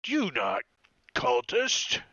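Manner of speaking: loud, halting ogre question; broken-speech ogre, rising at the end. Acted. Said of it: "he says".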